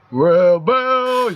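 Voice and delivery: name stretched out very slowly; dramatic reveal. Acted. Game narrator revealing the player's idol, drawn-out dramatic reveal. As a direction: draw the name out like a grand hero announcement.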